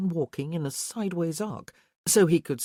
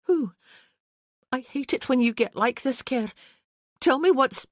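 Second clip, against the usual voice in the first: higher, louder, pleading exasperation; frustrated Isabel; 9 semitones higher, 5 dB louder than usual. Acted. Isabel demands answers from silent Care, exasperated and high. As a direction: push him, fed up and scared.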